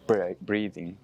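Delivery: ordinary talk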